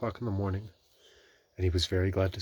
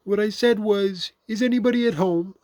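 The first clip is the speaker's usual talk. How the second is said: very loud call; 10 dB louder than usual. A narrator's reading. Pooh repeating himself into the hole, very loud. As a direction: call it out deliberately and loudly. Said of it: "called Pooh very loudly".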